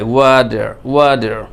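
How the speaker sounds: American "wa-der" for "ওয়াটার", final r sounded; American accent. Shown as a demonstration. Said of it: "আমেরিকানরা এভাবেই বলে". (আমেরিকানদের মতো "ওয়াটার", "ওয়াডার")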